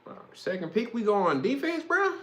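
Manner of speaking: high, disbelieving wow; shocked fan reaction; incredulous. Retold or imitated. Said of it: "But I was like".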